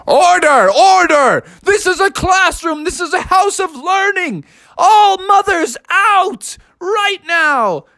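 high, imperious call to order; outraged teacher. Acted. Teacher to invading mother frogs, outraged. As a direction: command the room, stretching the final order.

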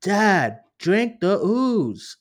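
high, loud, drawn-out plea; hammed-up imitation of a daughter urging her dad; mock movie daughter. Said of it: "the part when she's like".

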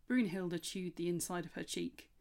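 ordinary delivery

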